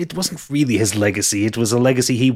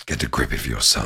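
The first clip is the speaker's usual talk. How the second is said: low, gruff talking cat; a cat telling him off, lower; 12 semitones lower, 4 dB quieter than usual. Acted. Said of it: "just goes".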